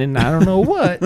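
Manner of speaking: hammed-up Southern pandering; fake Southern accent. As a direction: trail the phony Southern voice along. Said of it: "she tries that Southern accent".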